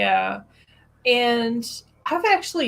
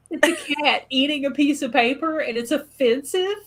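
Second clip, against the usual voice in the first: high-pitched retold outrage; incredulous; 7 semitones higher, 4 dB louder than usual. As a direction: protest in exaggerated disbelief. Retelling indignant reaction to takedown.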